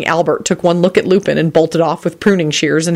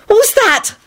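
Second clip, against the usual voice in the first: very high, panicked cry; terrified shout; slower than usual. A narrator's reading. Elizabeth shouting after the explosion, terrified. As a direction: cry out in terror, pitch shooting up. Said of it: "shouted in a terrified voice".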